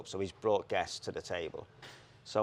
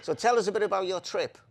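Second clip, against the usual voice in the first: high, loud mock Welsh interviewer; mock Welsh accent; 11 semitones higher, 8 dB louder than usual. The Welsh interviewer asks the jockey, mock Welsh accent. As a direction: squeak it out like the Welsh reporter. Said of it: "so she was Welsh".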